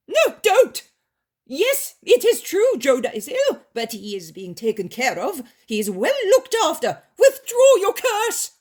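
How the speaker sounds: panicked pleading. Acted.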